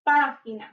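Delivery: "Página" with the stress on the first syllable. Shown as a demonstration. Teacher showing where the accent falls, stressing it. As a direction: hit the "Pá" in "Página". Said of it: "con acento en la primera A".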